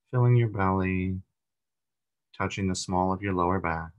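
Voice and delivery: calm meditation leader, long pauses between phrases; guided meditation. Acted. Leader guiding a group through breathing and body relaxation, meditative. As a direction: guide them gently into relaxation, unhurried, leaving room to breathe.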